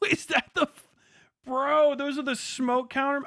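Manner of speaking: high, loud thrilled reaction; excited. Real, not acted. Watching the ship fire smoke, excited outburst.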